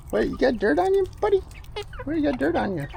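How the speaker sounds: high cooing voice to a chick. Real, not acted. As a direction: talk to the bird in a high voice. Talking to a chicken.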